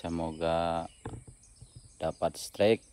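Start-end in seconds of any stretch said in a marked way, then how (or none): none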